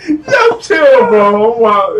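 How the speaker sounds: weepy, high, laughed-out words; helpless laughter. Real, not acted. Recovering from his own bearded dragon joke, helpless laughing. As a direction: beg for mercy while cracking up.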